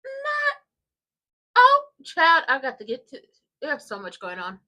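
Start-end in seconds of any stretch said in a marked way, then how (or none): none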